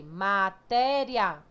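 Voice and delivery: very slow syllable-by-syllable Matéria; separated syllables. Shown as a demonstration.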